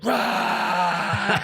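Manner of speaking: mock crowd roar; crowd roar imitation. Imitating the studio crowd, a short mock roar. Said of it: "And they went".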